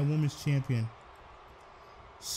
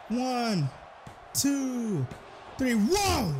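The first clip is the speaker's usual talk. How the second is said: excited count; 8 semitones higher, much slower than usual. Real, not acted. Commentator counting the pinfall for viewers, high excited count. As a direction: count the pin and explode at the end.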